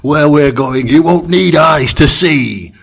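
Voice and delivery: slow, lightly voiced solemn movie line; a hint of Sam Neill quoting Event Horizon, drawn out; Sam Neill impression. Quoting Sam Neill's grim Event Horizon line to his cohost, slow. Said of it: "I was doing a Sam Neill impression".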